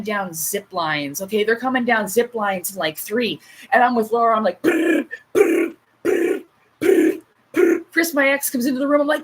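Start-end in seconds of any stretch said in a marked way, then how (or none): 4.6-7.8 high, trilled machine-gun bursts; loud rolled "brr" shots; imitated gunfire. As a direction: roll out mock rapid gunfire.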